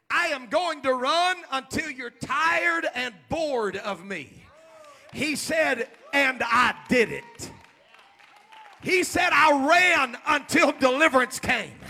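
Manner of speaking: shouted, driving sermon cadence; preacher urging the congregation to believe; full-force preaching. Acted. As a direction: hammer the message home.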